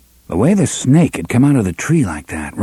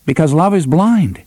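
teasing; 6 semitones higher than usual. A narrator's reading. Cuthbert teases Roland about love.

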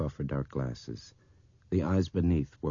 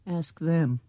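put-on high, loud child's voice; trembling little girl; 13 semitones higher, 6 dB louder than usual. A narrator's reading. Blind girl appealing to the others, trembling. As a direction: push it high, near tears. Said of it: "Her voice was trembling".

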